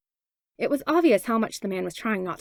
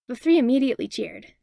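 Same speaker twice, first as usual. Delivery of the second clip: usual speaking voice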